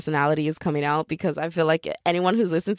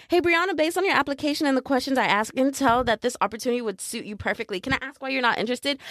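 fast, slightly raised message-reading voice; mock polite sales patter; chirpy recruiter; 4 semitones higher, faster than usual. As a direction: rattle it off like a scripted recruiter.

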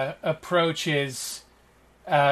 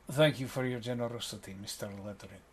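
courteous Ravi; 2 semitones lower, 4 dB quieter than usual. Acted. Ravi thanks a generous patron, quiet and courteous. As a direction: thank them formally and quietly. Game master plays Ravi.

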